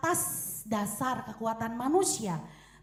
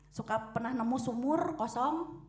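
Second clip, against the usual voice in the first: ordinary talk, nothing marked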